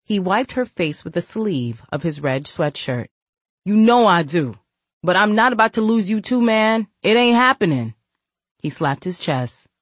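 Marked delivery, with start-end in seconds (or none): 3.6-7.9 loud, insistent big brother; forceful raised voice. Acted. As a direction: push it hard and fast.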